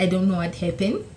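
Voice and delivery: normal talking voice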